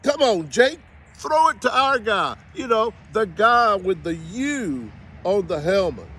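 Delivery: loud, high plea to a player; exasperated yelling. Pretend. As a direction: holler it like a fed-up fan. Yelling at quarterback after interception.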